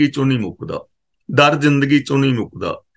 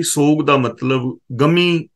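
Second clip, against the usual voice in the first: normal talking voice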